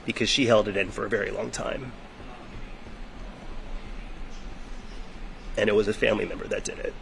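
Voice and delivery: quiet, halting, close to crying; fighting tears between long pauses; holding back tears. Real, not acted. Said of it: "this is hard for me".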